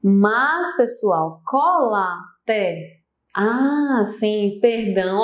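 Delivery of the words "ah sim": ordinary talk, nothing marked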